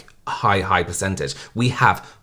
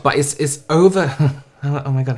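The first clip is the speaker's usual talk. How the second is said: usual speaking voice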